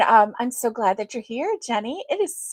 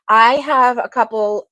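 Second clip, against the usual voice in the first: ordinary talk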